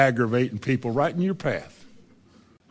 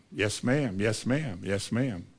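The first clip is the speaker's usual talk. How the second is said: soft, low, meek submission; quietly giving in, lower and softer; meek yes ma'am; 6 semitones lower, 9 dB quieter, slower than usual. Retold or imitated. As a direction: swallow it and mumble politely. Meekly taking a scolding.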